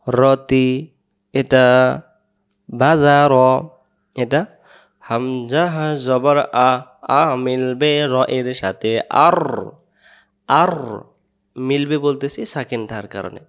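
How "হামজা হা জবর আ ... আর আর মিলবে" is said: হামজা হা জবর আ said very slowly, each part drawn out; slow spelling drill. Shown as a demonstration. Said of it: "এমন করে উচ্চারণগুলো বারবার করবেন". (ধীরে ধীরে বানান করে)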